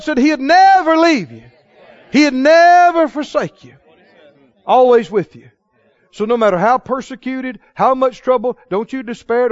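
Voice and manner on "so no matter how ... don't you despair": ordinary talk, nothing marked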